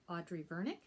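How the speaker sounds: plain reading voice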